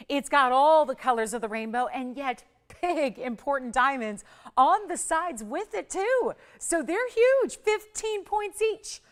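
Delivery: loud, high pitch with stretched emphasis words; sales pitch hype. Real, not acted. Host selling the huge side diamonds, excited hype. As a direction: build excitement, climbing high on the big selling points.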